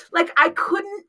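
big high laugh into words; cracking up while explaining the car. Real, not acted. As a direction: laugh hard, then talk through it. Laughing at own story.

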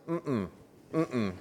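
mouth-covered little head-shake hum; muffled mm-mm denial. Retold or imitated. Guilty toddler denying to her daddy, muffled hum.

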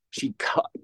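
choking on a drink; words caught in the throat. Real, not acted. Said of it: "excuse me, I'm just choking".

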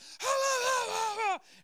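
high, loud imitated wail of a yelling child; mock screaming. Dad imitating daughter yelling behind the shut door, mock screaming. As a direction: imitate a kid yelling wildly through a door. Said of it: "she's on the other side of the door just".

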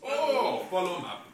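eager dim-witted brute; caveman voice. Acted. Barbarian excited broken speech.

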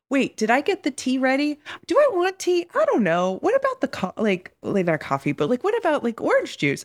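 high, darting questions to herself; a flustered inner self jumping between thoughts; frantic inner voices. Retold or imitated. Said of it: "six versions of myself going like".

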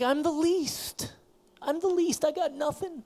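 whiny weak man. Retold or imitated. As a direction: whimper that you are the least. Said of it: "kind of a whiny, kind of a weak".

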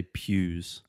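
pews said slowly and clearly; slow model word. Shown as a demonstration. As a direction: draw out pews for the learner. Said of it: "we have a weird word".

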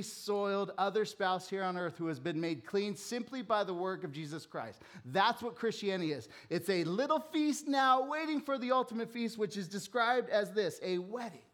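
rising to a loud, high sermon climax; full-force preaching. Acted. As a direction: preach it out to the back row.

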